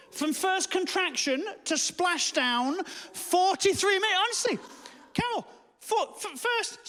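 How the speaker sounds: high, drawn-out disbelief; incredulous. Real, not acted. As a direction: stretch each phrase out high in exasperated amazement. Exasperated boast about short labour.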